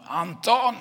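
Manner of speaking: loud, high-pitched yell; angry call. Acted. Angry neighbour tears the door open, shouting for Anton. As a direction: holler the name, stretching out the end. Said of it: "sliter upp dörren och ropar".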